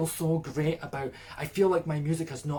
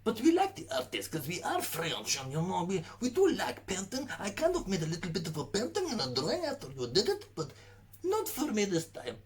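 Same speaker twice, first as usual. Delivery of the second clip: high, hammed-up Russian Ukrainian accent; 7 semitones higher than usual. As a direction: play a French reviewer drifting into a Russian Ukrainian accent. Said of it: "I'm kinda going into a Russian Ukrainian accent".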